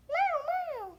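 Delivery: high pretend cat meows; swooping kitty cry; imitated meow. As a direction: mew like a little kitty. Plays the cat.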